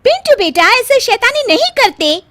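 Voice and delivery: high, loud mock scolding of a child; aunt scolding a child for breaking the lamp. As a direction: tell off a naughty boy. Scolding child who broke lamp.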